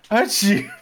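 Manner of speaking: loud playful sneeze with a laugh; sneezing. Pretend. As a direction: burst out a hammy sneeze. Imagined sneeze from grinding pepper.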